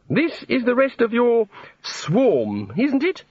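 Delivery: high, slow god voice; drawn-out, high-pitched query from a god; inquisitive god. A narrator's reading. The god asks Ponder.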